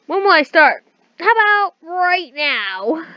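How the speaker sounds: loud, high, theatrical cry; hammed-up shout of eagerness; acted eager outburst. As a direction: burst out, drawing out the final words. Acted villain's eager outburst in diary.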